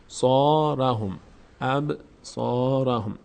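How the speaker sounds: ابصارهم drawn out slowly, Arabic sounds; teacher models ابصارهم slowly for students; slow Quranic recitation. Shown as a demonstration. Teacher models the word slowly for students.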